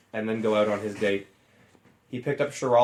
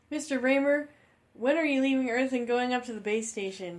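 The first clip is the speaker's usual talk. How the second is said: put-on kid student voice; 12 semitones higher than usual. A student asking the teacher about launch in a high kid voice. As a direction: ask like a curious schoolkid.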